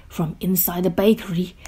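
ordinary delivery

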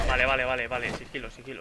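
sudden loud panicked vale; panicked burst. Real, not acted. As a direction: jolt of alarm, then hush to sigilo. Panicked after being spotted. (pánico repentino)

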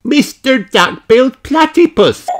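high, loud surprised greeting; delighted gasp. A narrator's reading. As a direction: spot a friend with delight. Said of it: "gasped Peppa".

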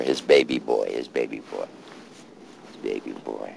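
grief trailing into a murmur; fading grief. Real, not acted. Repeating father's phrase, fading.

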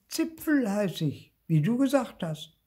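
high-pitched little ghost voice; tiny child ghost. Acted. Little ghost introduces himself to Lucy, childlike. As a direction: pipe up high like a small child.

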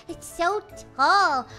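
ordinary delivery

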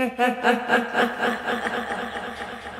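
long villainous cackle; evil laugh. Acted. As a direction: gloat with a drawn-out evil laugh. Villain reveals plan.

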